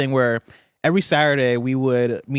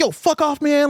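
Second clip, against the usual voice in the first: pitched-up retold yell, much higher; high mock scream; 12 semitones higher than usual. Yelling at the pizza guy, high mock scream. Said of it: "I was screaming in a tone that I don't scream".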